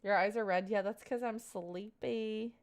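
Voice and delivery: normal talking voice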